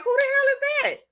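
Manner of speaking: very high, incredulous squeal; shocked listener. Retold or imitated. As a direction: act out stunned disbelief, way up high. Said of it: "you gonna be like".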